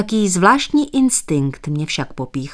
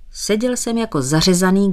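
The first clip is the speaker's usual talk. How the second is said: usual speaking voice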